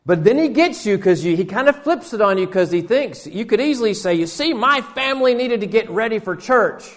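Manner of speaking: preacher in full flight, pitched high and loud; full-force preaching. Acted. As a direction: build the story into a fiery sermon climax. Story peaks into full preaching.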